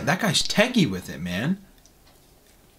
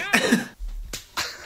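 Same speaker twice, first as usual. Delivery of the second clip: high laugh; 4 dB quieter than usual. Real, not acted. Laughing at clip.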